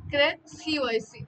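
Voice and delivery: usual speaking voice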